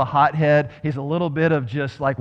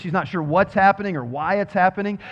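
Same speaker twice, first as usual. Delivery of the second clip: ordinary delivery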